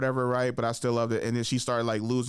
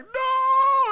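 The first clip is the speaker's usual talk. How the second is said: shouted no; 7 dB louder than usual. Retold or imitated. Acted outrage at weight loss.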